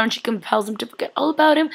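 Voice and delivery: usual speaking voice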